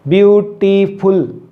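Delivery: ब्यूटीफुल slowly split as ब्यू-टी-फुल; syllable by syllable. Shown as a demonstration. Teacher showing learners separate articulations, syllable by syllable. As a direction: break ब्यूटीफुल into three distinct beats. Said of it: "अलग-अलग आपके articulators use होते हैं".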